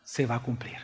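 low, soft voice with weighty pauses; hushed, solemn preaching. Real, not acted. Preacher reveals to the church who the Lamb is, solemn hush. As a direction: quietly let the revelation settle.

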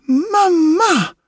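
high, loud cry of joy; joyful cry. A narrator's reading. New puppet calls to his mother, high joyful cry. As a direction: call out to her in delight. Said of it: "I cried".

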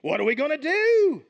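frightened plea for what to do; scared disciples calling out to Jesus in a panic; scared followers. Retold or imitated. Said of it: "They're a little scared".

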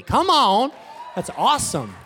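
loud, high burst of excitement; excited shout. Real, not acted. Pastor showing the new building to the church, excited shout. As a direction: shout it out, thrilled. Excited about donated venue.

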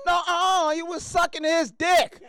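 put-on high, loud child voice, slow; insistent little girl. Daughter repeating accusation in high child voice. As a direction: insist like a stubborn kid. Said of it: "She said".

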